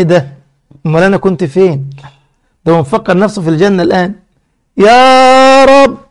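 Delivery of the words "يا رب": long-drawn high, loud cry to the Lord; imploring call. Retold or imitated. Servant nearing Paradise calls to his Lord, imploring. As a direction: call out to God, stretching it out.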